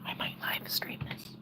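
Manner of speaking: whisper. Real, not acted. Whispered aside.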